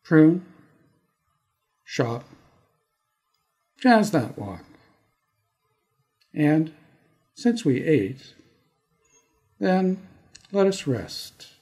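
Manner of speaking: measured, dramatic poem recital with long pauses; poetry reading. Acted. Poet reading his Toronto poem for podcast listeners, measured and dramatic. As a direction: perform the poem, letting each image land.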